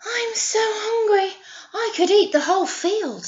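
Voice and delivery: high, eager hungry goat voice; middle-sized billy goat. Acted. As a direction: squeak it out, starving. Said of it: "said the middle-sized billy goat".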